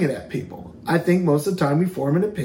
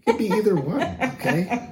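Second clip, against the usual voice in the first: laughing through the words; chuckling real laugh; 5 semitones higher than usual. Real, not acted. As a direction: giggle as you say it.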